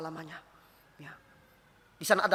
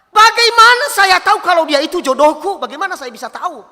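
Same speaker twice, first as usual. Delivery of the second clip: high, loud, pleading question; questioning young person; 10 semitones higher, 13 dB louder than usual. Retold or imitated. Young person asking the pastor about a soulmate, acted insistent plea. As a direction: act out an insistent young asker. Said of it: "anak muda bertanya kepada saya".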